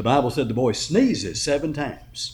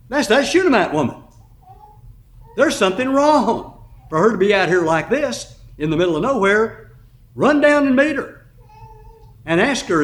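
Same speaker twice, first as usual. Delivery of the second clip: louder, rising alarm as he orders the servant out; urgent command; 5 semitones higher, 5 dB louder than usual. Retold or imitated. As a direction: hurry him off, alarmed. Prophet urgently sends servant.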